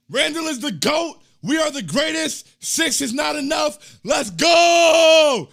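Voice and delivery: loud, hyped-up chat reading; hype shout. Retold or imitated. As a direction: shout it like a fan in the stands. Reading hype chat comment aloud.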